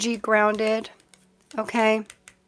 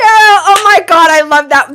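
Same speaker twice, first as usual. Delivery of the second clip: much higher and louder, slow burst of delight; delighted squeal; 8 semitones higher, 14 dB louder than usual. Real, not acted. Reader reacts to a viewer's Fifty Shades of Tarot joke, delighted squeal.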